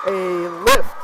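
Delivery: sudden high loud call; startled, jump up on it; surprised call. Real, not acted. Sudden referee call noticed.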